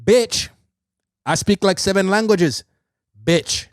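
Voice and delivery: high mock retort with set-apart phrases. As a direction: replay the comeback, pausing around each bookend. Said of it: "He bookended the fucking bitch".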